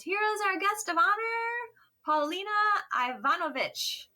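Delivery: high, showy master-of-ceremonies voice; mock posh hostess introduction; grand announcer. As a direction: present the guest of honor like a host on stage. Speaker introduces the guest of honor.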